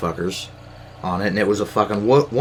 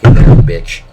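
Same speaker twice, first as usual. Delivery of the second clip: shouted much louder, spitting it out; angry shout; 3 semitones higher, 15 dB louder than usual. Real, not acted. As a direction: yell it at them in anger. Ranting at offended immigrants.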